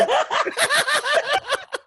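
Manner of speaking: a high rising laugh. Real, not acted. Still laughing at the uncle's nickname joke. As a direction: lose it laughing.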